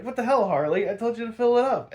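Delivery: high, loud mock rant, fast; angry Joker. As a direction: chew Harley out like a cartoon crook. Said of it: "Joker's like".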